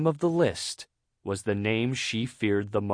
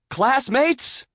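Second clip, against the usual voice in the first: high, loud oratorical address; grand speech opening; 13 semitones higher, 5 dB louder than usual. A narrator's reading. Student opening speech to classmates, orating. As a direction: hail the audience grandly.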